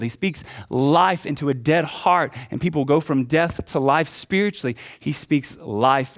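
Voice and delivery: high, loud, driving sermon delivery; impassioned preaching. Real, not acted. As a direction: preach it with rising fervor, punching the key words. Preaches at full force.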